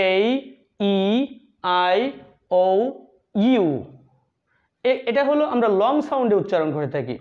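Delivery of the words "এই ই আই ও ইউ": "এই ই আই ও ইউ" slowly, one by one; each vowel name held long and set apart; correct long vowels. Shown as a demonstration. Teacher repeating vowels for learners, long and separated. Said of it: "আসলে উচ্চারণ হবে".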